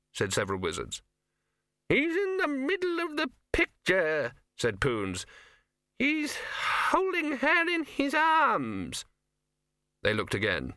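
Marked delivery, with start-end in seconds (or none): 1.9-9.0 put-on high, quavering old wizard; creaky doddering old man, high pitched. Poons voice.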